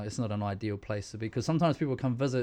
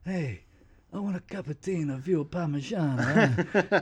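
put-on Italian-American mobster accent, pitched up; mafia guy; 5 semitones higher, 3 dB louder than usual. Joking with the nurse, mock mafia accent. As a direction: ham up a Sopranos wiseguy. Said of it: "binge watching Sopranos".

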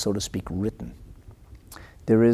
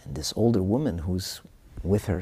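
ordinary talk

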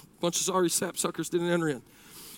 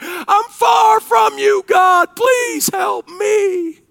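very high, loud, drawn-out wail; desperate begging; 10 dB louder, much slower than usual. Acted. Sinner far from God begging for help, desperate cry. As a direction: wail the prayer at full force.

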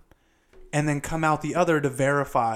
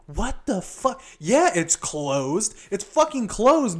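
incredulous; 6 semitones higher, slower than usual. Retold or imitated. Retelling his confusion.

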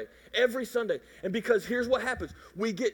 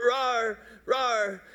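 playful rawr. Pretend. Lion roar imitation.

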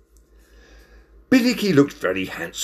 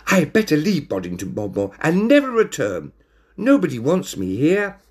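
higher-pitched lament; forlorn; 8 semitones higher than usual. A narrator's reading. Sacked cat decides to leave town, forlornly. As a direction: lament with a touch of self-pity.

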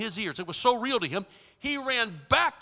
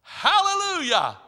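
high, drawn-out shout of praise; joyful hallelujah; 8 semitones higher than usual. Real, not acted. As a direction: lift it up slowly in praise. Preacher's exclamation.

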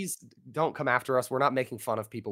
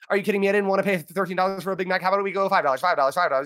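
auctioneer haggling; 5 semitones higher, faster than usual. Retold or imitated. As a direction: haggle fast like an auctioneer at McDonald's. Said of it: "you're like".

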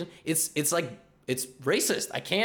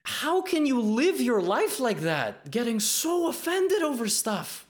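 high, slow, incredulous; exasperated disbelief; 9 semitones higher, slower than usual. Real, not acted. To the audience about offended viewers, high and slow in disbelief. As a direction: stretch it out like you can't believe it.

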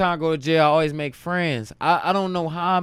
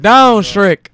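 much louder, higher shouted order; shouted command; 7 semitones higher, 15 dB louder than usual. Retold or imitated. Quoting P D's movie line, loud shout. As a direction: bark the order down hard.

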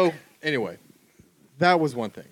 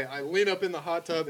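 ordinary talk

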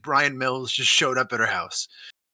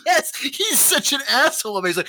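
normal talking voice

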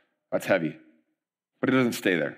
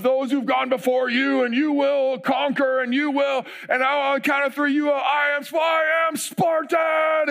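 overhyped race announcer; 12 semitones higher than usual. Retold or imitated. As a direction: pump up the crowd on a mic. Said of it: "he has you repeat these things".

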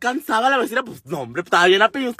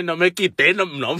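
ordinary talk, nothing marked

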